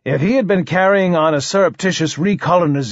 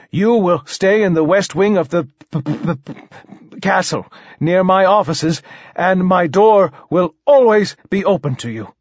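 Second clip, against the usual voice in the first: higher, welcoming voice with a bad stammer; stuttering king; 5 semitones higher than usual. King welcomes poet into the castle, stammering. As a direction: offer hospitality, halting on the P.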